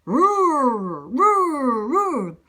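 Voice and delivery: drawn-out rolling roars; repeated storybook bear roars; big bear roar. A narrator's reading. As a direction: roar like a grizzly, rising then falling. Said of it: "He has the biggest roar".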